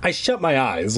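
plain reading voice

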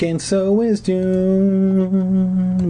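slow, grand chapter heading; announcer voice. Acted. Podcaster announces the new chapter, drawn out and grand. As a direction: stretch it like a show host.

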